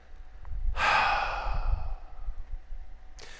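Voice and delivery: long, breathy, exaggerated sigh; weary sigh. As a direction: let out a long, burdened sigh. Reluctant vlogger dreads making the video.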